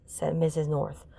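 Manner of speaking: ordinary delivery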